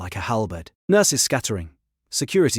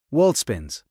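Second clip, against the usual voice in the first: plain reading voice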